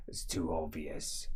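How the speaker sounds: a low, quiet, unimpressed aside; a deep, muttered brush-off; gruff NPC. Acted. GM voices the same NPC.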